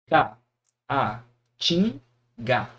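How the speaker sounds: Ca, a, tin, ga separated with pauses; syllable by syllable. Shown as a demonstration. Teacher to students, splitting caatinga into syllables. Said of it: "Vamos lá, ó".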